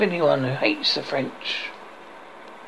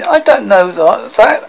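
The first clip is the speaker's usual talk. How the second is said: louder, higher mock panic; panicked knight; 5 semitones higher, 8 dB louder than usual. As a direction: blurt it out in alarm. Knight fails the bridge question.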